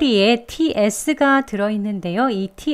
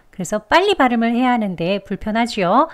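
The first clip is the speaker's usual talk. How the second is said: ordinary talk, nothing marked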